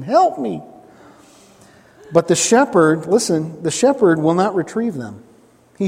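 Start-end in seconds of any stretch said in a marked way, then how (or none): 0.0-0.6 high-pitched, plaintive sheep voice; comic high bleat for help; sheep impression. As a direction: plead like a stuck sheep. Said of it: "my sheep impression".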